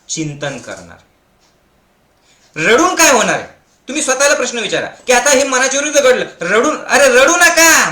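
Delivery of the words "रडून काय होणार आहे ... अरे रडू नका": high and loud, pressing fast then slowing on रडू नका; loud preaching rebuke; loud scolding exhortation. Acted. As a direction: rouse them out of their tears.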